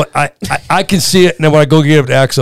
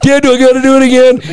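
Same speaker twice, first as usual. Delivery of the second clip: put-on high whining child; whiny kid; 9 semitones higher than usual. Hurt son asking dad to ride again, high childish whine. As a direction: whine it like a reluctant little boy. Son voiced in dad's story.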